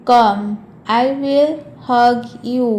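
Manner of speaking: COME, then I WILL, HUG, YOU spaced out. Shown as a demonstration. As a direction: stretch it out, separating HUG and YOU. Slower repeat for learners.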